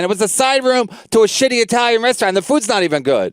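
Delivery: ordinary talk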